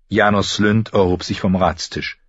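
ordinary delivery, nothing marked

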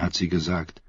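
ordinary delivery, nothing marked

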